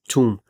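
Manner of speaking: छूम with a breathy t; छूम in a mock British accent; British pronunciation. Shown as a demonstration. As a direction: say छूम, t puffed like a Brit. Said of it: "गोरा पढ़ता है".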